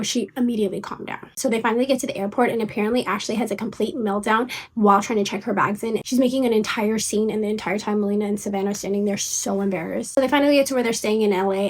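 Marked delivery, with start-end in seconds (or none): none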